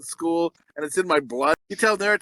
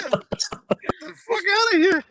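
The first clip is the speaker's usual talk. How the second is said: slow, higher, breaking into laughter; laughing; 14 semitones higher, much slower than usual. Real, not acted. Host at the kiss chant, laughing hard. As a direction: push it out through real laughter.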